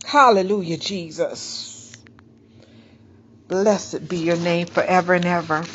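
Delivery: low, hushed worship murmur; quiet prayer. Acted. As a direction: breathe the praise out softly to Jesus. Murmured worship after the reading.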